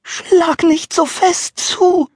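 very high, slow, tiny pleading voice. Acted. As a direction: beg like a little piece of wood. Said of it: "das flehend bat".

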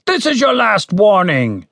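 high, slow, carrying shout; shouted warning. A narrator's reading. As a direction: shout a final warning from outside. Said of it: "shouted the voice from outside".

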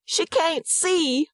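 high, slow cry of anguish. A narrator's reading. Mother repeating that her baby is blind, anguished. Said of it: "she repeats in anguish".

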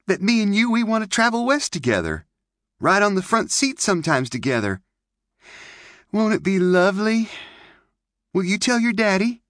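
put-on high, bright little-girl chatter; girlish May voice. Young girl May chattering to Clint by the camp. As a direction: play the pert pioneer girl.

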